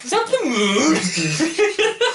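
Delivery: high, loud, drawn-out disbelief breaking into laughter; hammed-up astonished cry; mock bewilderment. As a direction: act out a comically shocked discovery. Voicing bewildered hungover party guest.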